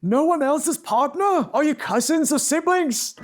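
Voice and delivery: put-on overdone English accent, like a drunk guy; drunk-sounding English accent. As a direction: imitate a tipsy English bloke. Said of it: "Accent, that is, that just sounds like a drunk guy".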